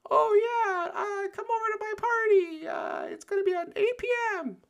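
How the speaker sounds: hammed-up pitch-shifted high voice, almost shouting; super high pitched voice. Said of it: "pitch shift his voice super high".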